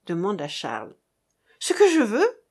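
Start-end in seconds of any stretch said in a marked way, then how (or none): 1.6-2.4 high, loud indignant exclamation; acted outburst of fierce resolve; energetic cry. A narrator's reading. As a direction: throw the question back with rising force. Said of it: "s'écria-t-elle avec une énergie croissante".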